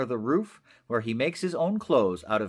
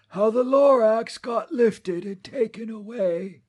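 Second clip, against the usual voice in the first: put-on higher, wistful old storyteller voice; old Onceler reminiscing; 8 semitones higher, 4 dB louder than usual. Onceler recalling the green days, wistful and higher. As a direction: lean back into long-ago memories.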